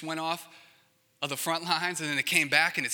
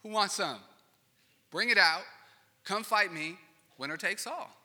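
higher, louder mock challenge; Goliath taunting; 5 semitones higher, 4 dB louder than usual. Goliath calling out the Israelites, loud mocking taunt. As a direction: call out the army like a bully. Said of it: "starts taunting him again".

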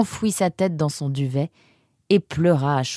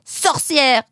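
high, loud accusing cry; yelled insult; 11 semitones higher, 4 dB louder than usual. A narrator's reading. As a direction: hurl the insult from afar. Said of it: "hurla-t-il en direction de la serre".

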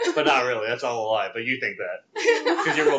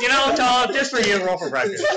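teasing; 8 semitones higher than usual. Acted. Teasing the player.

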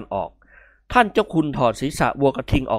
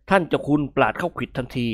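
plain reading voice

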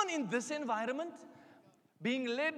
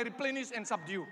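ordinary talk

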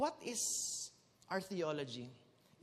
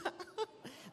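laugh; 10 semitones higher, 7 dB quieter than usual. Real, not acted. Laughs at his own joke.

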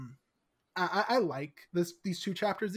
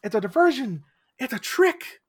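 rising alarm, getting louder and higher; urgent realization; 4 semitones higher, 5 dB louder than usual. Retold or imitated. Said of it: "She's like".

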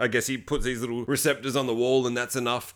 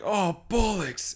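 higher, very slow dismayed groan; dismayed curse; 7 semitones higher, much slower than usual. Retold or imitated. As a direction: let it out slowly, higher. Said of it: "He's like".

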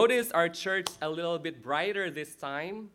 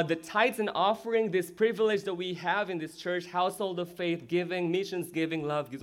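ordinary talk

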